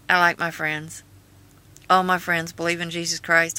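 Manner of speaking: ordinary talk, nothing marked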